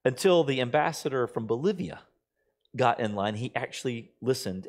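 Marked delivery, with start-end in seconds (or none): none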